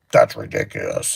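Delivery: deep, gruff mock-angry customer; a lightly voiced irritated customer; mad customer. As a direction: play the annoyed customer scoffing. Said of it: "He's all mad".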